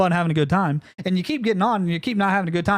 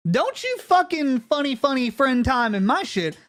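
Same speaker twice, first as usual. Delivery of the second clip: ordinary delivery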